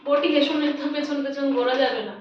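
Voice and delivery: ordinary delivery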